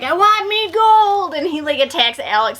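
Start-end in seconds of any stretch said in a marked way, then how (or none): 0.0-1.3 high, slow mock leprechaun growl. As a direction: drag it out like a greedy little leprechaun. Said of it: "the leprechaun's like".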